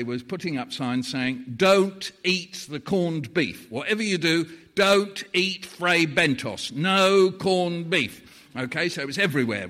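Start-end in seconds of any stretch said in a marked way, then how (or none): 1.6-8.2 slow, loud public-warning announcement; mock warning. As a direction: boom it like an official notice. Public warning signs read out.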